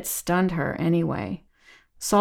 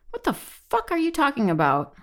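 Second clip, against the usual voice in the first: high-pitched incredulous anger; indignant outburst; 7 semitones higher than usual. A narrator's reading. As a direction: challenge them in outraged disbelief. Indignant reply to harasser.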